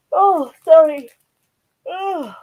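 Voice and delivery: real yawn, pitched high. Real, not acted. Vlogger showing resin pieces to viewers, yawning an apology. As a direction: apologise through a sudden yawn. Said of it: "I'm yawning".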